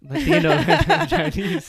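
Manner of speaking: real laughter breaking through, high and loud; laughing. Real, not acted. Co-host joking about his languages, laughing. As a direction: crack up while finishing the sentence.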